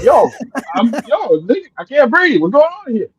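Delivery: put-on high, frantic gasping child; panic, can't get air; panicked. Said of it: "And I'm like".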